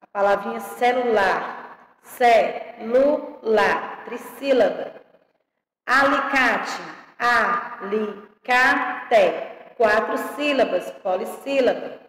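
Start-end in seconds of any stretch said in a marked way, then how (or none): 2.0-4.0 very slow "Celular", ce-lu-lar in separate beats; syllable by syllable. Shown as a demonstration. Teacher showing how the word separates, syllable by syllable. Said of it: "como é que se separa". 7.2-8.5 slow "alicate", a-li-ca-te in four spaced beats; syllable by syllable. Shown as a demonstration. Teacher showing how the word separates, syllable by syllable. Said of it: "como é que se separa".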